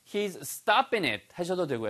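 ordinary talk

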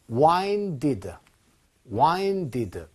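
와인디드 drawn out slowly as "wine-did"; regular past, WINE-did. Shown as a demonstration. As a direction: say 와인디드 slowly, long i plus -ded. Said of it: "이렇게 규칙 동사처럼".

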